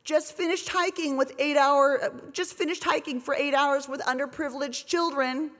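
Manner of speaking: mock a self-satisfied reality star; lightly voiced preening celeb; mocking celebrity tweet. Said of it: "and she said".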